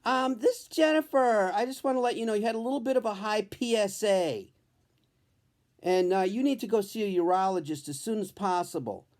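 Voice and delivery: a hint of a breezy doctor delivering news; Doctor Jennifer's voice. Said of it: "She goes".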